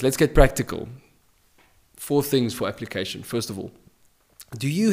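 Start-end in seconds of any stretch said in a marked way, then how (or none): none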